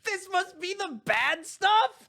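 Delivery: high, slow whiny fake cry; mock crying. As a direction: whimper it like a fake sob. Mock-crying repeat of quote.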